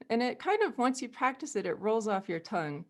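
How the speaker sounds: ordinary talk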